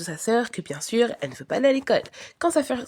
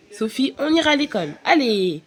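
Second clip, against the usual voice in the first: ordinary delivery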